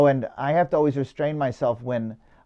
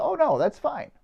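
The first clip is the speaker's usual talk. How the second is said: much higher, imitating an agreeable woman; breezy reassurance; 11 semitones higher than usual. Stranded woman accepting one more stop, breezily imitated. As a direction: wave it away lightly, pitched up high.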